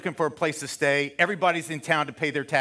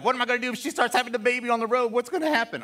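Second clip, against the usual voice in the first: higher and fast, a frantic worried rush; panicked fretting; 8 semitones higher, faster than usual. Retold or imitated. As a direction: rattle it off like a man in a panic on the road.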